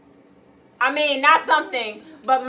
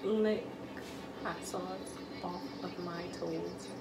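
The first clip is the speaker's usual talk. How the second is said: whisper; 9 semitones lower, 17 dB quieter than usual. Acted. Whisper challenge phrase again.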